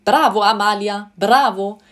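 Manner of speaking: loud, delighted cheering; a man cheering his lady on; enthusiastic bravo. A narrator's reading. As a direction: call it out like applauding a great shot. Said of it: "rief erneut der Mann und klatschte begeistert".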